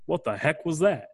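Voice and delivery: lightly voiced goofy nerd; a touch of dorky bewilderment, retold; Kurt Angle. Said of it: "goofy, like, nerdy Kurt Angle".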